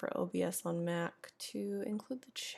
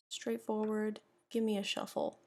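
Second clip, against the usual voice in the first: ordinary talk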